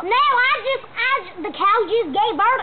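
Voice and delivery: high-pitched Southern farmhand; excitable put-on Southern accent. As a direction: chatter in a hammed-up Southern accent. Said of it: "doing the Southern accent".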